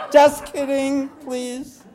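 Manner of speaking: high, loud burst through laughter; laughing protest. Real, not acted. Speaker taking back a joke about marriage, high laughing protest. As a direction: plead it out while laughing.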